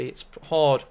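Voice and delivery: usual speaking voice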